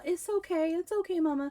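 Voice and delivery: put-on high, soft child's reassurance; Wolfie. Child soothing his panicking mother, sweet high voice. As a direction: comfort Mama sweetly, imitating a little kid. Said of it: "he's being so sweet".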